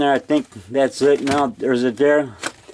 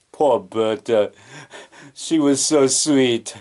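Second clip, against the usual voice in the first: slow, mournful character voice; imitating Cajun Joe mourning, slowly; Cajun Joe's voice; 2 semitones lower, slower than usual. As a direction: drag out a sorry lament for Bertha. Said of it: "that's how he talks".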